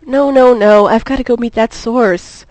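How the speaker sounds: loud frustrated growl, rising high. Acted. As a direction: snarl it at yourself, frustrated. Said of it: "Lois growled to herself".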